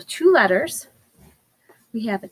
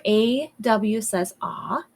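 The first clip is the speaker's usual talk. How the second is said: slow teaching voice; slower than usual. Shown as a demonstration. Teacher reminds class of the sound, slowly. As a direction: draw each word out slowly. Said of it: "So remember".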